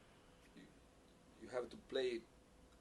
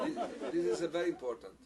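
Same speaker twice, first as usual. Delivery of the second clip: laughing; 5 semitones higher, 7 dB louder than usual. Real, not acted. Laughing while stressing importance.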